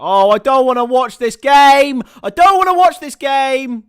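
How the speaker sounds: loud, high, slow mock despair; mock wail. City fan moaning to viewers about the painful match in loud mock despair. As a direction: wail like a fan who can't bear the game.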